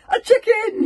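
very loud, high shout; relieved yell. Acted. Bergamo, seeing only a chicken, yells in relief. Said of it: "yelled Bergamo in relief".

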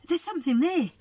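high-pitched acted astonishment; wide-eyed storybook amazement; startled discovery. A narrator's reading. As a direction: exclaim high, as if spotting something huge. Eddie spots something in the woods.

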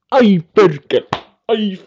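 high, loud ouch and curse; mock pained yelp. Streamer acting out being stung, high mock-pained yelp. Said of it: "Ja sitten se pistää".

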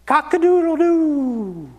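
high, drawn-out rooster crow; long falling cock-a-doodle-doo at moderate volume. Acted. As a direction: crow like a rooster, trailing off slowly. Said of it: "the rooster crowed".

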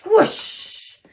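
loud, breath-filled whoosh; dramatic swooping whoosh; whooshing sound. A narrator's reading. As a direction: imitate a rushing swoop. Dragon swoops to the rescue.

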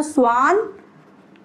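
स्वानजिश very slow, split as स्वान and जिश; pronunciation demo. Shown as a demonstration. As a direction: stretch स्वान, pause, then give जिश ending in sh. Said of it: "twenty को बोला जाता है". (स्वानजिश, बहुत धीरे, दो हिस्सों में)

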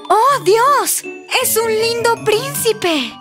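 high, amazed exclamation; very surprised princess. Acted. Princess seeing a handsome prince appear, high astonishment. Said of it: "está muy sorprendida".

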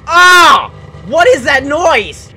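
high, loud cry of alarm; horrified yell. Real, not acted. Gamer hearing a new mob scream, horrified yell. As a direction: shout over the awful noise.

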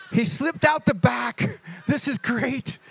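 high-pitched mock glee; gleeful relief. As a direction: re-enact a private celebration. Acts relieved inner thought.

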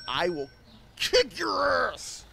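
slow, high, loud hammed-up yell; mock shouted threat. Shopkeeper threatening a customer, slow mock shout.

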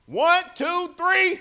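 loud high count-in. Shown as a demonstration. Pastor counting the congregation in, loud. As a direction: call the count up high for everyone. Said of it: "on the count of three".

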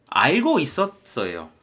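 slow teaching read with 알고 stressed. Shown as a demonstration. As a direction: lean on 알고 as you teach it. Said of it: "알고 있었잖아고".

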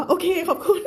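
high, laughing okay thanks. Real, not acted. Teacher laughing as she thanks a viewer. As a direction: giggle the thanks out.

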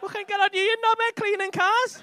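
high, loud laughing jeer at a mate; laughing mock shout. Real, not acted. Teasing Jack about his building business, loud laughing jeer. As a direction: bellow it out while cracking up.

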